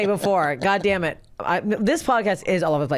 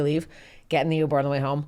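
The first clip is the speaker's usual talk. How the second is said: ordinary talk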